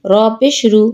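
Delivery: no stretch on রু; short vowel. Shown as a demonstration. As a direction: end on a short রু. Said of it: "কোথায় দ্রুত পড়ি".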